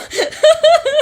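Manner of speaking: high loud laugh; burst of laughter. Real, not acted. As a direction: let a big laugh burst out.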